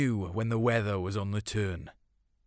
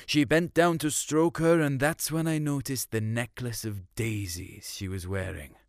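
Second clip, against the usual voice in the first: plain reading voice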